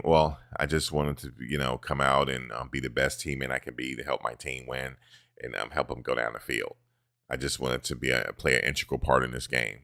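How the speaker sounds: soft, low, rehearsed player answer; hint of a bland athlete cliché voice; mock Travis interview. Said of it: "Y'all know what Travis be doing".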